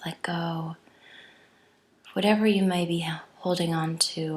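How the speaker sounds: hushed, unhurried yoga-teacher guidance; guided meditation. Real, not acted. Leads breath meditation.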